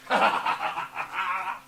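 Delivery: a loud, coarse cackle; mocking laugh. Hyde laughing at the servants he just mocked. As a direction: laugh in their faces.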